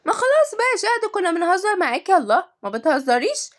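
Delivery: put-on high-pitched wheedling kid; whining child. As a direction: protest in a whiny little voice. Child whines back at teasing.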